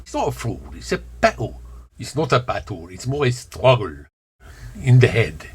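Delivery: hidden Bronx accent showing; slipped accent. Shown as a demonstration. Speaker correcting himself about the struggle, in slipped Bronx accent. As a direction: let the accent slip toward Bronx. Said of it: "where my accent slips".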